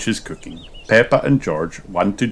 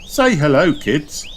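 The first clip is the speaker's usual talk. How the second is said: put-on high, lively mum prompting the kids; mother nudging her kids to greet; Missus Kangaroo; 8 semitones higher than usual. A narrator's reading. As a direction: coax the children to say hello, pitched up. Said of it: "adds Missus Kangaroo".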